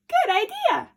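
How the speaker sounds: put-on eager little girl; delighted. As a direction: chirp with glee. Little Red agrees.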